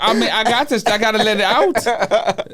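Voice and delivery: talking through real laughter; laughing. Real, not acted. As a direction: giggle through the words. Laughing after being scolded for yawning.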